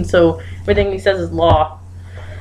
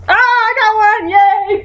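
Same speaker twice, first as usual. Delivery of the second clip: high-pitched delighted yell; excited cheer; 12 semitones higher, 3 dB louder than usual. Real, not acted. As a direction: cheer your first win, high and thrilled. First right answer in game.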